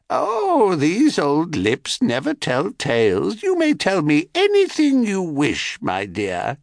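put-on wheedling old hag; crone voice. Crone coaxing Semele.

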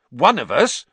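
sharp, loud angry question. A narrator's reading. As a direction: snap it out angrily.

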